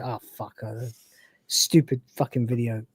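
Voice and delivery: low, soft grumble; muttered annoyance. Real, not acted. Reviewer cursing the broken video, low and soft. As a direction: grumble under the breath.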